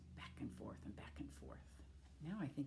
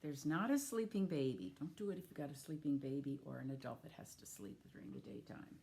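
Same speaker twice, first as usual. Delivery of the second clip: usual speaking voice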